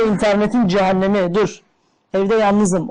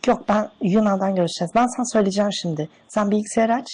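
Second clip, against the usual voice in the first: man doing a woman's voice. Pretend. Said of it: "Oğlum ben kadın sesi yapamam ki".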